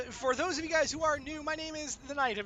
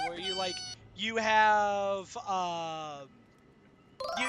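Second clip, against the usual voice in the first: ordinary delivery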